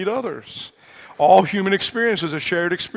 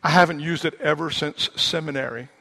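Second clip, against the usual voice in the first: ordinary talk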